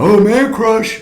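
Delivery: high-pitched imitation of teasing friends; mock teasing. Male friends ribbing him over Phelps, mock teasing. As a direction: tease in a put-on high voice.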